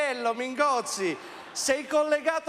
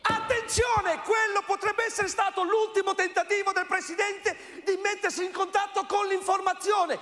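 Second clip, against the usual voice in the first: dramatic urgent announcing; 5 semitones higher than usual. Acted.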